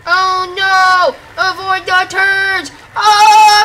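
high, loud panicked cries while dodging; excited shouting. Real, not acted. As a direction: yell it out in real panic as the boat swerves. Panicking steering a boat in game.

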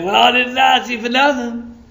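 high-pitched huffy retort; mock indignation. Imagined stranger retorts to preacher, mock indignant.

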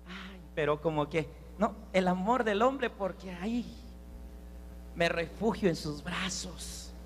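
low, soft, put-on reluctant voice; mock lovesick woman. Preacher mimics a señorita choosing man's love, mock lovesick. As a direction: play a half-hearted, swooning excuse.

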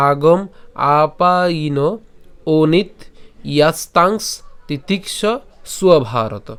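verse line in separate chunks, pause after each; broken-up recitation. Shown as a demonstration. Teacher repeating the last line for learners, deliberately segmented. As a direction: recite piece by piece, giving each part weight. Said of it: "শেষ লাইনটা যদি আমি আরেকবার বলি".

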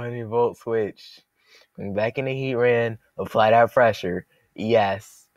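ordinary talk

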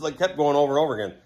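usual speaking voice

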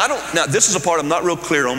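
ordinary talk

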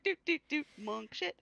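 high, silly doot-doot monk; oblivious monk. Pretend. Player imagines the monk carrying on elsewhere, oblivious and high-pitched.

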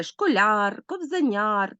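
"школяр, кобзаняр" with a drawn-out final syllable; stressed suffix ЯР. Shown as a demonstration. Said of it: "обов'язково наголошений суфікс яр".

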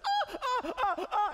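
high, loud choppy screams with laughter; stuttering high shrieks; broken scream. Retold or imitated. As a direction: scream in bursts, half laughing. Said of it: "it's not consistent".

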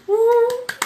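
a sudden high, loud squeal of delight; short happy shriek; excited squeal. Real, not acted. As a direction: yelp with joy at the good news. Excited reaction to a viewer's party news.

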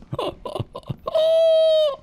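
high staccato laugh swelling into a long wail; Repose's laugh. Retold or imitated. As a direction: imitate a laugh: short bursts, then one long high held note. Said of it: "It's my best repose impression".

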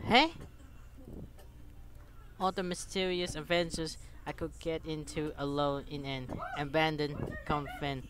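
ordinary talk, nothing marked

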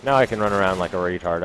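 normal talking voice

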